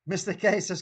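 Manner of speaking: usual speaking voice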